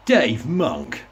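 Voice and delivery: a light put-on local DJ voice; name-drop him in his own smarmy broadcaster voice; phony radio DJ voice. Mocking a BBC Essex DJ, lightly put-on DJ voice. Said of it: "who spoke like that".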